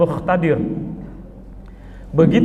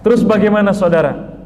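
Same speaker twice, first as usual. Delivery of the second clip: rousing call; 5 semitones higher, 4 dB louder than usual. Real, not acted. Preacher rouses the congregation.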